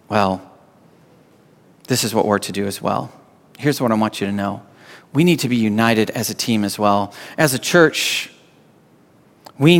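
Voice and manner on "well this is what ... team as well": sincere appeal. Real, not acted. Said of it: "pastor turns to the church".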